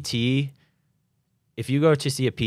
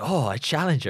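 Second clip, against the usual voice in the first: higher-pitched mock French accent; French-accented catchphrase, pitched up; 6 semitones higher than usual. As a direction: imitate the Frenchman's accent, playfully. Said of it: "just 'cause that French guy".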